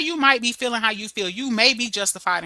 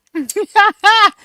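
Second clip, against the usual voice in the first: high loud laugh; 10 semitones higher, 9 dB louder than usual. Real, not acted. Laughing at her own grammar.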